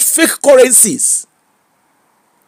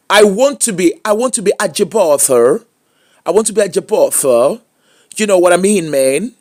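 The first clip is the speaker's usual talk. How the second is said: mock American phone accent. Vlogger swearing to speak only phone from today, mock American accent. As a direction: roll it out like a fake Yankee. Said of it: "na phone na I go dey speak".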